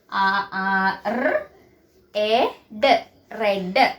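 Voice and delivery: "ർ, എ, ഡ്" sound by sound, then blended "റെഡ്"; phonics sounding out. Shown as a demonstration. Teacher shows pupils how red is read, sounding it out. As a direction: separate the sounds, then join them into "റെഡ്". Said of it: "pronunciation ശ്രദ്ധിക്കണം".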